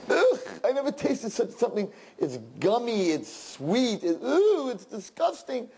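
high, revolted wife voice; wife spitting out the bird in a high, revolted voice; disgusted wife. Acted. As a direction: recoil from the taste. Wife spits out cooked bird.

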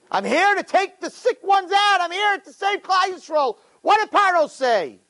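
high-pitched, ringing sermon delivery; impassioned preaching. Real, not acted. Preacher tells of the awaited nation's birth, high fervent cry.

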